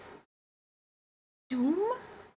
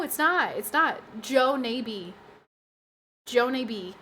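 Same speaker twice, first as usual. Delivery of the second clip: usual speaking voice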